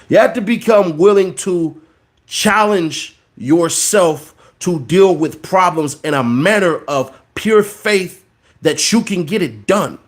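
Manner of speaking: ordinary delivery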